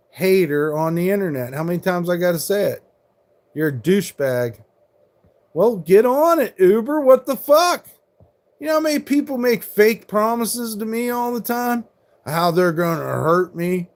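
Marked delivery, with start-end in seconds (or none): 5.5-11.8 high, loud angry shout at the trolls; furious yelling at chat. Real, not acted. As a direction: snap back at them, full volume. Angry stream rant at chat trolls.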